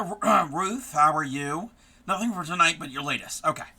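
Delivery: ordinary talk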